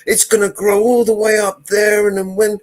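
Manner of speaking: high, loud hammed-up scolding; wife raging at her husband, hammed-up and loud; mock scolding wife. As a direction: berate him like a hysterical wife. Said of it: "she goes".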